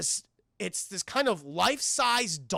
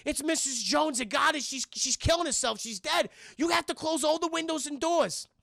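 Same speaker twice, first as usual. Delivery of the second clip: high-pitched, breathless-sounding mock alarm; frantic warning; 8 semitones higher than usual. Mark Wahlberg warns his family, high frantic urgency. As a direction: urge them in a raised, panicked voice.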